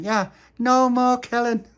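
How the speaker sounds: very high, slow, eager yeah; squeaky echo; high squeaky agreement. Acted. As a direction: chime in like a tiny doll.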